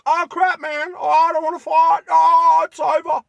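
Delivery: high, loud squeaky panicked little voice; voicing scared hairs. The hairs squeal in panic fleeing the knife blade. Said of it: "They're like".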